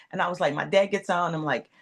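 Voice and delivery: usual speaking voice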